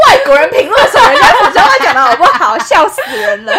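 laughing. Real, not acted. Laughing at themselves.

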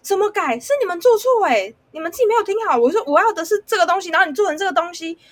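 high and loud, blaming tone; indignant scolding. Retold or imitated. Coworker in the kitchen scolding the server, loud and indignant. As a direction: push the blame back hard.

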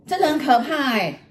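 high-pitched indignant telling-off; imitated angry scolding. Husband angry at wife for scaring him, imitated scolding. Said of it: "就很凶".

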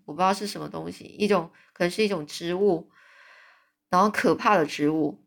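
ordinary delivery

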